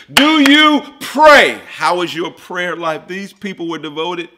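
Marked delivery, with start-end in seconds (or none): 0.1-1.6 loud, high pointed question; challenging shout. Real, not acted. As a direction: call it out to the whole room. Preacher challenges congregation.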